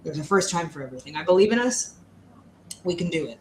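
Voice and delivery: ordinary talk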